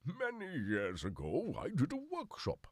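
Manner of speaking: drawn-out, swooping high theatrical voice; theatrical storyteller. Pretend. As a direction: intone it grandly, stretching the words. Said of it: "I don't know why I've gone".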